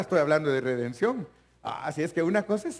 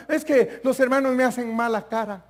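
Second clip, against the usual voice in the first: mock complaint; 7 semitones higher, 5 dB louder than usual. Acted excuse.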